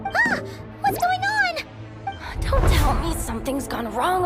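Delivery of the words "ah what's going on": startled panic. Acted. Rider jolted in a stalled lift, startled panic. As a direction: shriek of alarm at very high pitch.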